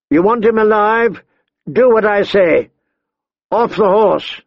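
high-pitched, forceful orders; commanding threat. A narrator's reading. Big man bargaining with the boy's life, commanding. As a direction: give orders as the one holding the hostage. Hostage-taker giving orders.

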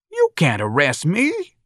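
high, drawn-out protest; outraged old-timer, pitched high; old man crying out. A narrator's reading. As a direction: cry out in slow disbelief. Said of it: "the old man cried".

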